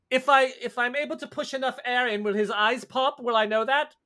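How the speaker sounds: high, curious, odd aristocrat; eccentric noble voice. Acted. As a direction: play a gleeful eccentric. GM voices Caligula, high pitched.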